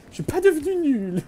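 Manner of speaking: high mock denial. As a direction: squeak it out like a wounded ego. Repeats line in put-on voice. (voix aiguë et moqueuse)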